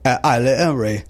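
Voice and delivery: mock Cockney mumble; bad British accent. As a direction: swallow the consonants, hammy British. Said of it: "make my son laugh by saying".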